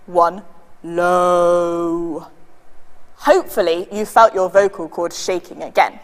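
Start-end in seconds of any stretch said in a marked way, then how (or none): 0.8-2.3 "low" held long and low; deep sustained demo of "low". Shown as a demonstration. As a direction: drop the voice right down and sustain "low". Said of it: "say it in a very low tone of voice".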